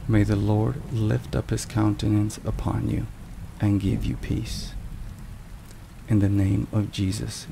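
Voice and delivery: ordinary talk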